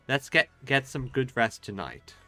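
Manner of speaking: usual speaking voice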